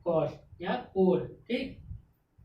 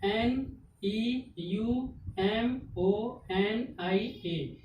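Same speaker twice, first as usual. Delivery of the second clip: spelling out letters; much slower than usual. Shown as a demonstration. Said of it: "teacher spells word letter by letter".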